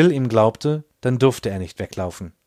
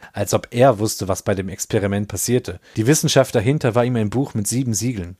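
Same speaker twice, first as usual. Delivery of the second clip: plain reading voice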